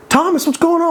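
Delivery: high, loud innocent greeting; imitating George. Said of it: "What's up, George?".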